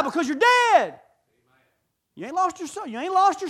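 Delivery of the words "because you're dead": sudden loud, high shout; shouted verdict. Real, not acted. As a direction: hammer the point home.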